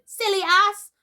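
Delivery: very high, loud fairy jeer; shouted insult. A narrator's reading. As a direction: hurl it mockingly from overhead. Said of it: "Tinkerbell shouted".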